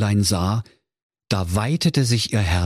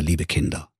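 usual speaking voice

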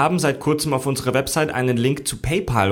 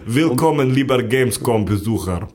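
put-on Russian accent; 3 semitones lower than usual. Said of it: "Synchronsprecher mit russischem Akzent".

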